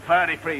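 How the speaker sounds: "Thirty-three" said as firty-free; Londoner's TH as F. Acted. London job applicant giving his age, "Thirty-three" as firty-free. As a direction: swap both THs in "Thirty-three" for F. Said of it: "the Londoner has trouble with the T and the H".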